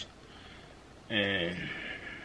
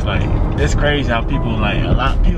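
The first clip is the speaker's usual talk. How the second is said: loud heartfelt message; 3 semitones higher, 11 dB louder than usual. Real, not acted.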